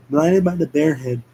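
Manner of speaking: ordinary delivery, nothing marked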